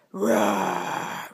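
drawn-out mock dragon roar. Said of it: "roared the dragon".

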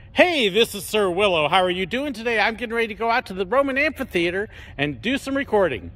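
upbeat YouTube intro voice; Sir Willow intro voice. Shown as a demonstration. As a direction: open the video like a cheerful host. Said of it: "do my normal Sir Willow voice".

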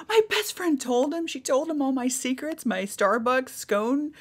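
high-pitched retold dismay; hammed-up scandal in a high voice; mock betrayal. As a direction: act out dismay at being exposed. Realising her friend betrayed her secrets.

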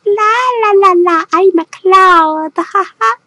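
high, sing-song little cloud. Pretend. Painter voicing a cartoon cloud on the shoe, high and sing-song. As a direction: give the painted cloud a tiny squeaky voice.

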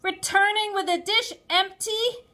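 high, loud scolding question; shrill parental outburst; mother's shout. A narrator's reading. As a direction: shout in disbelief at your child. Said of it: "my mother shouted at me".

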